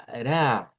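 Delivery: ra with one light tap; soft R. Shown as a demonstration. Teacher presenting the soft r, tapped ra. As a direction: tap the r of ra once, no trill. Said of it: "la R suave".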